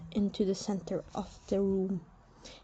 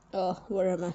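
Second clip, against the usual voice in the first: ordinary delivery, nothing marked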